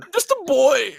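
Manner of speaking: high, put-on whiny voice; mock whiny echo. Echoing the host's tease in a high whiny voice. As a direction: whine it like a little kid. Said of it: "echoes host's line in mock voice".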